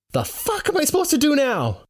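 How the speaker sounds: very high, exasperated question; panicked demand. Acted. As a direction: demand help in alarm. Narrator stuck with two snakes.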